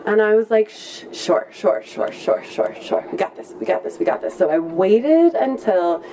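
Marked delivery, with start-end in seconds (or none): 0.7-4.3 whispered sure, sure, hyping herself up; a nervous whispered pep talk. Retold or imitated. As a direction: psych yourself up under your breath. Nervous self-quote, girlfriend bails.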